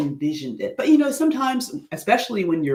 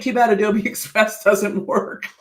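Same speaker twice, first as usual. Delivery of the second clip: higher voice breaking into laughter; laughing; 5 semitones higher, 4 dB louder than usual. Real, not acted. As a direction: laugh through the complaint. Laughing at the software failing.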